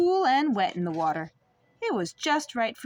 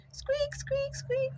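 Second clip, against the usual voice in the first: high, soft squeaking noise; squeaky squeak; 4 dB quieter, much slower than usual. Acted. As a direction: make the tiny squeaks of the egg. Egg squeaking sound.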